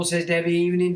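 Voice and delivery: ordinary talk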